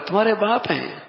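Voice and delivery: high-pitched disbelief; astonished. Shown as a demonstration. Preacher amazed that this is the child's father, astonished. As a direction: say it as if you can hardly believe it. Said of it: "میں حیرت کا اظہار کر رہا ہوں".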